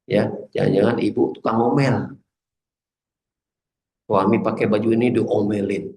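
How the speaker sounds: usual speaking voice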